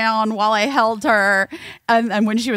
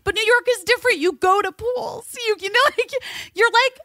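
much higher, coaxing, apologetic; high pleading to child; 9 semitones higher than usual. Retold or imitated. Mother to upset daughter, explaining New York pools, high and coaxing. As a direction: plead gently like a guilty mom. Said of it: "I'm like".

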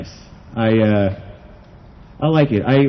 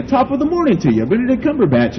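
mock Irish greeting; 12 semitones higher than usual. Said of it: "It's just like a".

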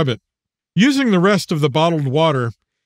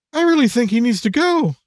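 put-on young girl voice; 7 semitones higher than usual. Daughter pleads with father.

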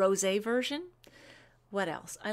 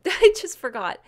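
embarrassed laugh; 5 semitones higher, 11 dB louder than usual. Real, not acted. Forgetting the winner's name on air.

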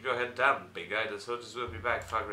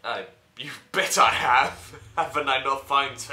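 loud boast; 12 dB louder than usual. Acted. Hero boasting in reply.

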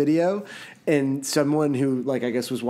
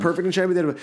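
normal talking voice

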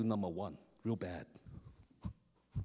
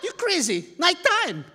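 loud, high disbelief; shocked kid shouting back; incredulous outburst; 13 semitones higher, 10 dB louder, slower than usual. Retold or imitated. Arguing with friend, retold.

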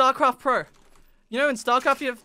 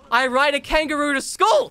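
louder mock-proud declaration; mock claim; 4 semitones higher, 7 dB louder than usual. Retelling what the online player believed, mock shout. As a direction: blurt it like an obvious fact. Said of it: "He was convinced".

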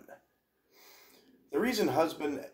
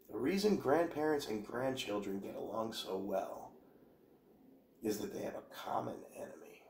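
much softer, sinking into an unvoiced whisper; conspiratorial whisper; 5 dB quieter than usual. Shown as a demonstration. Rereading the quote to viewers as a hushed whisper. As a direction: hush it like sharing a secret. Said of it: "second, whispered take of quote".

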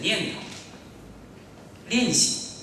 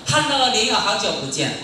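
mock social greeting; 5 semitones higher, 5 dB louder than usual. Distracted chanter greeting a fellow devotee, mock hearty call. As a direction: call out in imitation of a chatty devotee.

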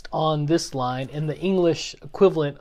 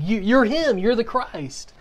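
high, excited burst of recognition; imitating Peter's excited declaration; 7 semitones higher than usual. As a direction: blurt it high, as if pointing him out. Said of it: "pointing at Jesus excitedly".

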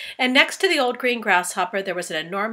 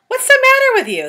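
much higher, louder, startled question; grasshopper asking; 12 dB louder than usual. Acted. Old grasshopper asking frightened James what's wrong, high and loud. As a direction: ask it in a high fussy grasshopper voice.

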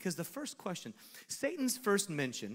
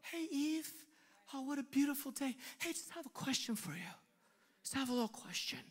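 sweet-talking Satan; 5 semitones higher than usual. Pretend. Satan sweetly sidling up to Eve with a question. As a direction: coax her gently with false charm.

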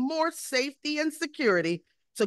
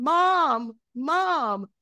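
put-on child calling mom; 5 semitones higher than usual. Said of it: "a little crying voice or somebody saying".

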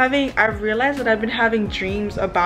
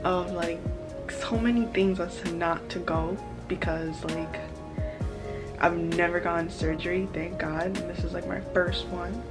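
quiet and anxious; 9 dB quieter than usual. Real, not acted. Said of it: "But I'm so anxious".

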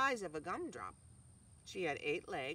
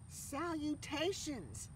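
slow, higher, drawn-out greeting; thin voice; 8 semitones higher, much slower than usual. A narrator's reading. Spider greets the pig in a thin voice. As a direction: stretch the fancy word slowly. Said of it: "the thin voice".

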